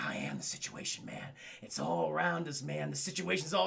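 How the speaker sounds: low, hushed, brooding impression; Marlon Brando. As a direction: murmur it softly like a jungle madman. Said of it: "he goes full, uh, Marlon Brando".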